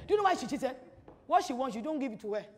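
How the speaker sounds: ordinary delivery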